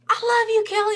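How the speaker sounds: high, loud declaration of love; adoring fan shout. Retold or imitated. Fan who got Kelly's attention, high adoring shout. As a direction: shout it to your idol.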